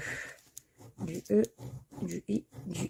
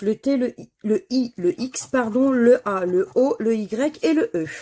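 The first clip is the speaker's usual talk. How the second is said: loud, steady letter call like a bingo caller; loud draw announcer; 3 semitones higher, 7 dB louder than usual. Acted. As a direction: announce each letter clearly to the viewers. Calling out the drawn letters.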